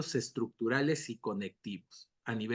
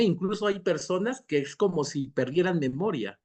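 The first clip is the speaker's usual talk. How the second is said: usual speaking voice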